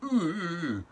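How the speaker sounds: high, loud startled ooh; a caught-out squeak of alarm; nervous ooh. Retold or imitated. Traveller caught by customs, startled high ooh. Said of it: "And I'm like".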